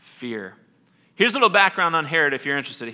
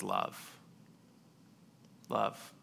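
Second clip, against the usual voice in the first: barely above a breath, each word alone; hushed repeated word; 5 semitones lower, 15 dB quieter than usual. Acted. Pastor voicing Jesus to the congregation, hushed. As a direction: let each love land softly. Jesus speaking love to listener.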